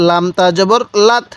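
usual speaking voice

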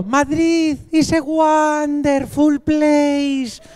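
very slow, high, over-enunciated English; tremendous tone. Retold or imitated. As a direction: speak like addressing aliens. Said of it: "el tono fue tremendo".

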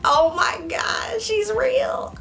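high, drawn-out fangirl squeal; starstruck gushing. Retold or imitated. Fan seeing her idol Joy up close, starstruck. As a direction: gush in high-pitched disbelief.

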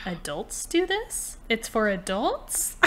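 high, drawn-out disbelieving question; incredulous mom. Retold or imitated. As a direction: imitate a baffled mother questioning it. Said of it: "my mother could not get it through her brain".

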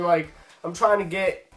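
usual speaking voice

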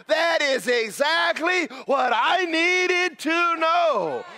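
slow, high, loud exclaimed quote; loud amazed realization; mock awed listener. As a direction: play the stunned note-taker. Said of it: "you're over there going".

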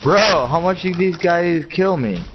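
slow, high drawn-out disbelief; exasperated disbelief. Real, not acted. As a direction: stretch it out in disbelief. Teammate killed repeatedly.

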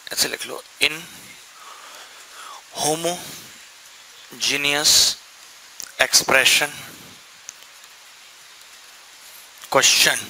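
each word drawn out for copying down; measured dictation to the class; slow dictation. Shown as a demonstration. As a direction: dictate slowly so students can write. Teacher dictating a statement.

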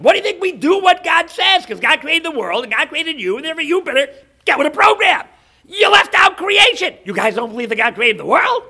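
loud, high, acted tirade; hammed-up royal rant; ranting king. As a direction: flip out and shout in outraged disbelief. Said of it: "the king flips out at him".

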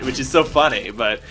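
normal talking voice

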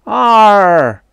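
long, high, loud alien holler; proud native cry. A narrator's reading. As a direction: crow over a lucky hit. Native crows after hitting her.

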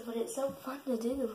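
ordinary delivery

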